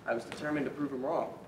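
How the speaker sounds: normal talking voice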